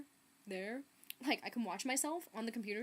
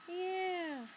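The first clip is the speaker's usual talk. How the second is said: long, high-pitched "yeah"; awkward reaction; 8 semitones higher than usual. Retold or imitated. Reader reacting to almost-kissing book cover, drawn-out awkward yeah. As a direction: stretch it out, pitched up and doubtful. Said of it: "a little bit awkward".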